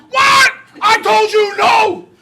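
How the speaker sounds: hammed-up outraged mom; furious mom yell. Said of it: "she starts screaming at dad".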